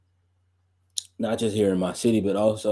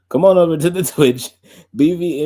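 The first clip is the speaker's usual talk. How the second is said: louder retry, laughing through it; laughing, louder plug; 6 semitones higher, 6 dB louder than usual. Real, not acted. Streamer redoing the channel plug for viewers, louder and laughing. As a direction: bounce back from the flub with a hype plug. Redoes the plug after a slip.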